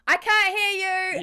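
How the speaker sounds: high, loud stage shout. Retold or imitated. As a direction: bellow to the back row. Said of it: "whole conversation that's like".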